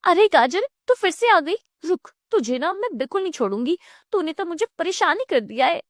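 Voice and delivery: high-pitched, agitated threat; angry. Acted. Savita wakes and threatens the imagined carrot, angry. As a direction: rush into an exasperated high-voiced warning. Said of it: "अचानक से उठकर गुस्से में कहती है".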